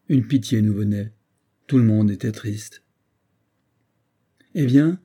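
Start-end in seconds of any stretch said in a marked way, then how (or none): 4.5-5.0 a sharp, higher call to Sobar; cry out. A narrator's reading. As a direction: call out to him, taunting. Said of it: "cria Rada à Sobar".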